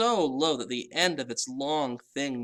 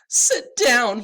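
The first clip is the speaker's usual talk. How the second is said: high loud wail; 11 semitones higher, 11 dB louder than usual. A narrator's reading. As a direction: wail it out in tears. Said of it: "he wailed".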